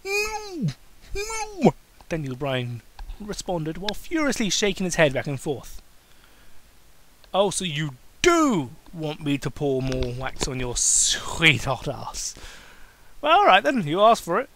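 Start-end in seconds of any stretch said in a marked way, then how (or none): 0.0-1.7 very high, panicked cries; desperate no. Acted. Daniel begging, shaking his head. 7.3-14.5 mock sultry taunt. Cain teasing then pouncing.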